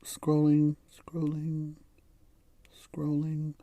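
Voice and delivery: soft, sustained monotone; absent-minded singsong under the breath; idle murmur. Real, not acted. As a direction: chant it quietly while busy reading. Idly scrolling while narrating.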